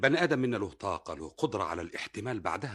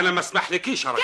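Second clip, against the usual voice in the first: loud protest; 9 semitones higher, 8 dB louder, faster than usual. Acted. Husband caught, protests loudly.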